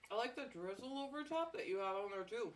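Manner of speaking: ordinary talk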